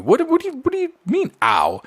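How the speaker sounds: very high squeaky disbelief; incredulous hairdresser. Retold or imitated. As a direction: squeak out a baffled question. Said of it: "she goes".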